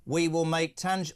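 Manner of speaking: usual speaking voice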